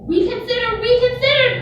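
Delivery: high, loud pleading goose; goose crying out. A narrator's reading. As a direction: cry it out like an anxious goose. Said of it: "cried the goose".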